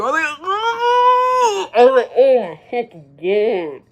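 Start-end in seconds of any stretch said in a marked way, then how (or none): 0.4-1.7 very high, loud drawn-out squeal of disgust. Retold or imitated. Retells own disgusted reaction. 2.2-3.8 slow, louder groan of disgust; acted-out disgust. Retells own disgusted reaction.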